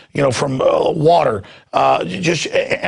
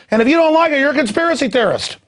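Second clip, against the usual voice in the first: righteous outrage, pitched way up; heated rant; 10 semitones higher, faster than usual. Real, not acted. Radio host to listeners, high angry rant. Ranting about critics being labelled.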